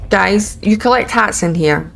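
usual speaking voice

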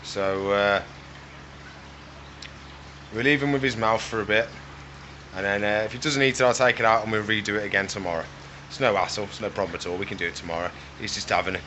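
normal talking voice

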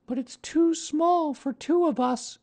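put-on very high, slow, sorrowful boy; sad child. A narrator's reading. Christopher Robin sees Pooh's tiny boat, sad high child voice. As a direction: lament it as a small boy. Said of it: "said Christopher Robin sadly".

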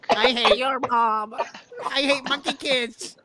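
high, drawn-out put-on voice; hammed-up, high-pitched caricature of a friend; mocking friend impression. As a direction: stretch it out high, mimicking a friend. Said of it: "all do an impression of each other".

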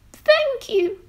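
very high thank you; a goat bleating its thanks; grateful goat. Acted. The goat thanking George for the shirt sail, very high. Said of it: "said the goat".

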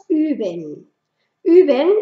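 üben, lips rounded for U, tongue for I; German ü sound. Shown as a demonstration. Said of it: "poniendo la boca en forma de U, pero pronunciando una I". (üben con boca de U y sonido de I)

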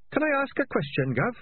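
timid high squeaky voice; eager but meek underling; meek high rat. Acted. Sardines asks.

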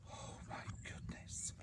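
breathy whisper after a huge sneeze; whispered dismay. Acted. Bear recovers from his sneeze, dazed whisper. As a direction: whisper it, as if spent.

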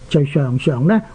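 normal talking voice

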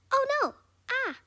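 loud, high cry of alarm; alarmed yelp. Real, not acted. Player fails on the jump pads, alarmed. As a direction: yelp as you slip.

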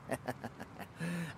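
soft, wicked vampire chuckle; sinister chuckle. Acted. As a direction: chuckle darkly to yourself. Vampire relishes the coming slaughter.

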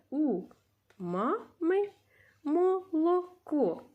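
teacher reading the sentence slowly to pupils; slow read-aloud. Shown as a demonstration. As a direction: stretch молоко out slowly. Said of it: "teacher reads sentence slowly".

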